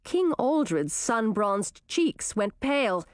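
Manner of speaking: ordinary delivery, nothing marked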